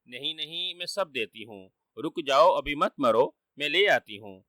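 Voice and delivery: ordinary delivery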